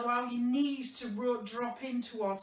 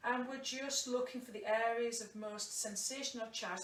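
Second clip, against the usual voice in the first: guided meditation; 4 dB quieter than usual. Acted. Yoga teacher guiding the class through a body scan, soft meditation voice. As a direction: ease them into the scan, calm and unhurried.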